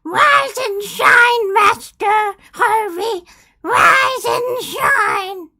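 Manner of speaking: high, loud, drawn-out character shout; wake-up call. Acted. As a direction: holler it slowly in a high put-on voice.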